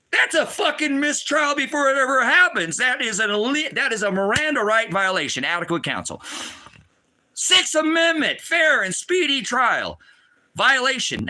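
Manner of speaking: high-pitched, indignant ranting; outraged, forceful protest; real outrage. Real, not acted. Outraged rant over forced trial.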